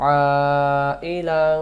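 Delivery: long madd recitation; four-count stretch. Shown as a demonstration. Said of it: "চার আলিফ".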